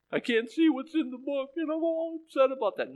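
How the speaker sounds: high, mocking whimper; mock whiny John. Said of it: "Why are you crying?".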